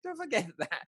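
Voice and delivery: high cartoonish protest; indignant inner voice. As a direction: squeak out an outraged correction. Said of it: "You go".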